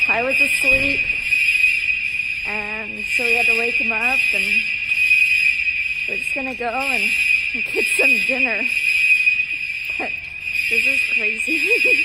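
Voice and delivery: shouting over fire alarm. Real, not acted. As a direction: shout to be heard over the noise. Fire alarm blaring in building.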